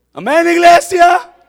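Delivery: loud, high, drawn-out pulpit call; slow ringing cry for a response. Real, not acted. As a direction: shout to rouse the congregation. Calls to congregation for amen.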